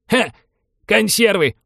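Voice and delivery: loud, high derisive snort; high-pitched contemptuous old geezer; scornful old man. A narrator's reading. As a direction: scoff at the offer as a cranky grandpa. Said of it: "презрительно фыркнул дед".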